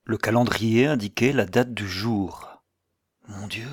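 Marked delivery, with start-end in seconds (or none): 3.3-3.7 very soft, under the breath; stunned murmur. A narrator's reading. Narrator stunned by the calendar's date, soft murmur. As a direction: murmur it, dumbfounded. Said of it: "murmurai-je".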